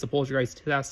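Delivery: usual speaking voice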